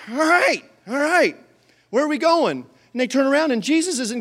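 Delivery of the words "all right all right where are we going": grudging surrender. Acted. Disciples give in to shouted order.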